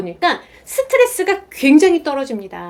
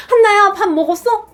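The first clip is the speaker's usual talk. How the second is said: bright, higher, louder call to her daughter; cheerful mom; 6 semitones higher, 4 dB louder than usual. Retold or imitated. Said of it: "굉장히 유쾌한 목소리로".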